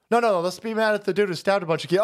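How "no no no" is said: ordinary delivery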